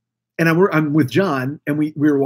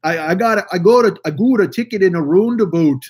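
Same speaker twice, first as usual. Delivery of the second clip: exaggerated Canadian vowels, pushed louder; Canadian accent; 3 dB louder than usual. Retelling a friend's ticket story, Canadian accent. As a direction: imitate a friend with a thick mock Canadian accent. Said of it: "she's all Canadian".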